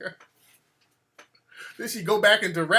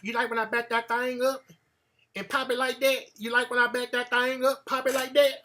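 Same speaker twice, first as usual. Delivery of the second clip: put-on country girl voice; 3 semitones higher, 3 dB quieter than usual. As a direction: tease him like a country girl. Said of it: "she be talking that country".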